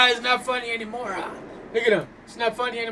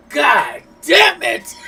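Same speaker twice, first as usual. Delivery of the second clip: loud, high, slowed curse; mock angry shout; 7 semitones higher, 11 dB louder, much slower than usual. Streamer imitating Chucky's interrupted ritual, loud mock shout. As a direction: belt out the frustration, hammed-up. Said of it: "He's like".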